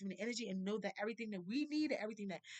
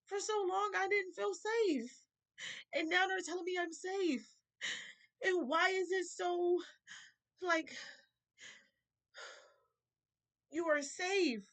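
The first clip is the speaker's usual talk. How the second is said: high, slow, tearful and breaking; crying; 7 semitones higher, 3 dB louder than usual. Real, not acted. Telling viewers source says she is safe, crying for real. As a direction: fight back real tears. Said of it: "That makes me emotional".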